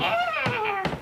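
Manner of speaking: put-on high, cackly old witch. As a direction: croak it like a witch. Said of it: "Like a witch voice".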